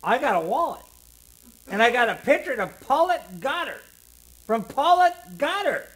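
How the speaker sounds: high, loud, excited shrill voice; Koenig's shrill boast. A narrator's reading. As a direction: squeal out the prize proudly. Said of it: "said Koenig shrilly".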